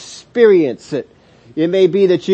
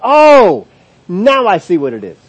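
sudden realization; 6 dB louder than usual. Pretend.

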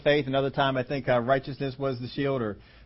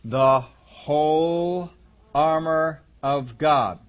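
"the whole" drawn out very slowly and louder; emphasis on WHOLE; 3 dB louder, much slower than usual. Shown as a demonstration. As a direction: spell out "whole armor of God" slowly, phrase by phrase. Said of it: "the emphasis here is on".

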